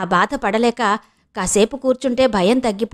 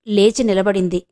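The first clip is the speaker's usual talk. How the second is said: ordinary delivery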